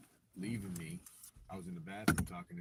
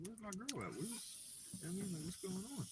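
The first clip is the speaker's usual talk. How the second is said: confused; 12 semitones higher, 4 dB quieter than usual. Retold or imitated. Retelling searching for girlfriend.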